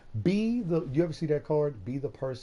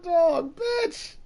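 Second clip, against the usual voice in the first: fake-cry the last words of the rant; mock crying; 6 dB louder than usual. Ranting at the ex who took the dog, mock sobbing. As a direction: voice cracking high into a hammed-up sob.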